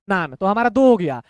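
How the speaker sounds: ordinary talk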